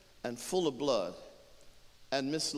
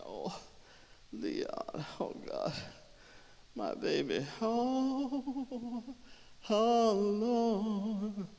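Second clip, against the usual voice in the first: crying; 8 semitones higher, much slower than usual. Acted. Woman crying for her baby Leon, mournful wail. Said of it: "And she's crying".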